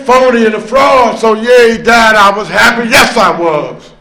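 ordinary talk

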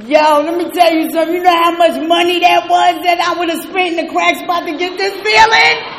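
loud, fast, fired-up exclamation; fired-up loud testimony; excited shout. Real, not acted. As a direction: blast it out to the whole room.

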